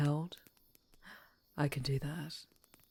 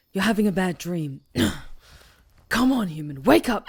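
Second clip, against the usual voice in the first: much louder, pressing call to rouse the sleeper; urgent loud wake call; 13 dB louder, faster than usual. Acted. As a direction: shake them out of a nightmare with your voice. Urgently shaking human from nightmare.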